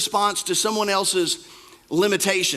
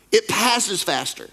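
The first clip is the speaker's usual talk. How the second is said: usual speaking voice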